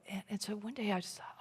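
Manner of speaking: breathy whispered confiding aside; whispered, half to herself. Real, not acted. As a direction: drop to a whisper as if sharing a secret. Hushed aside while telling story.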